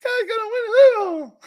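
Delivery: loud, very high puppet squeal; Tony Romo. Acted. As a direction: shriek it quickly like a ventriloquist's dummy. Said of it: "Say it a little louder".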